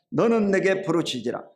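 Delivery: loud, measured verse recitation; leading a recitation. Shown as a demonstration. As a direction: call the scripture out for the congregation to follow. Leads congregation in reciting verse.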